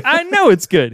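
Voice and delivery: very high mock-huffy comeback; indignant Lisa. Lisa bickering back at Homer, indignant.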